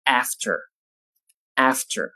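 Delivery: "after" with a flat front "a" and a final r, American style; American pronunciation. Shown as a demonstration. Teacher giving the American "after" after the British. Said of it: "美式英语中发作".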